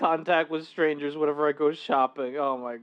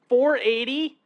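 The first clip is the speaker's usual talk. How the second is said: incredulous; 6 semitones higher than usual. Real, not acted. Repeats oven temperature in disbelief.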